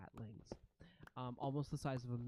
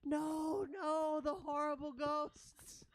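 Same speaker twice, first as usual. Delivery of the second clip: high, wailing rat-chorus cry; screeching rats; 13 semitones higher, 5 dB louder, slower than usual. Acted. Chorus of rats dreads the arriving ghosts, screeching wail. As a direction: screech it high in fearful singsong. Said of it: "this screeching chorus of rats".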